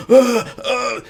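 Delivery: high, helpless gasping noises; imitated choking gasps. Friend with mustard straight up his nose, imitated gasps. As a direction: mimic someone burned by hot mustard.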